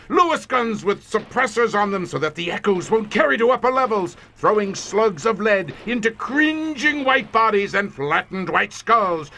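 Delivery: feverish relish. Acted. Commander describing the massacre to his visitor, high feverish relish. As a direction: revel in each detail of the killing.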